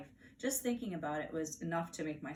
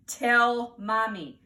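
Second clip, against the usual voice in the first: very slow, much louder order; loud demand; 3 semitones higher, 12 dB louder, much slower than usual. Acted. Mother demands Fudge confess, very slow loud order. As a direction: hammer out each word. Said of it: "mother upset".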